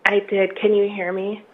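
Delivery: hoarse, croaky voice; frog voice. Real, not acted. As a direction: frog in the throat, rough voice. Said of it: "I apologize for my odd voice".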